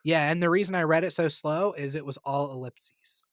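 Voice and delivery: ordinary delivery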